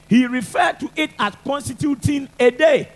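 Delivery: normal talking voice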